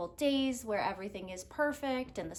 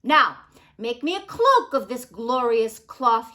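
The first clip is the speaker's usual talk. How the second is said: louder, commanding king; imperious royal command; king's order; 12 dB louder than usual. Acted. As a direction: bark the order like a demanding king. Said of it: "he ordered".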